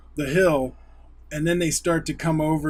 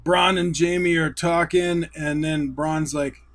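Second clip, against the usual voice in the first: usual speaking voice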